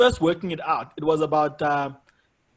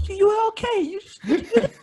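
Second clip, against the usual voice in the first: very high, thin consoling voice; pitying audience member; 3 dB louder than usual. Retold or imitated. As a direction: imitate an awkward well-wisher in falsetto. Voices pitying fan after a show.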